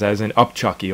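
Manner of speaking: ordinary delivery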